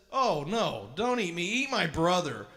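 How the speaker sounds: loud mock-whiny paraphrase; mock the goat selling out his brother; mocking whine. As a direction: sneer out the goat's cowardly plea. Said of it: "What kind of jerk says".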